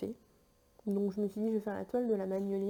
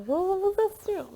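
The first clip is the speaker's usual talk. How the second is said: emphatic insisting; 9 semitones higher, 6 dB louder than usual. Real, not acted. Insists fabric less shiny.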